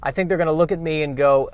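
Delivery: ordinary talk, nothing marked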